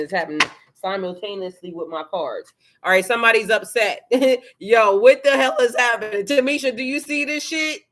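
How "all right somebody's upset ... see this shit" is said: loud, laughing disbelief; excited. Real, not acted. Laughing reaction to the reading.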